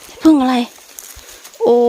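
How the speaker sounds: high, loud startled cry of เห็ดผึ้งอะไร; jolted by a sudden find, she blurts เห็ดผึ้งอะไร loud and high. Real, not acted. Forager startled by a mushroom in the forest, blurting it out loud. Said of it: "ข้อยละตกใจหมด".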